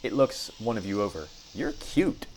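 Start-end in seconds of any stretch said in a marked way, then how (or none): 1.5-2.2 very high little creature voice; tiny spider complimenting the party, very high; high squeaky spider voice. Acted. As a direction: chirp it sweetly like a tiny spider.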